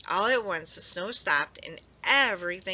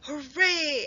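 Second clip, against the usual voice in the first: higher, bright hooray; cheer; 8 semitones higher than usual. A narrator's reading. Friends seeing Hedgehog safe, cheering. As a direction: cheer with joy. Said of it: "everyone cheered".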